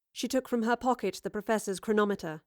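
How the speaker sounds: plain reading voice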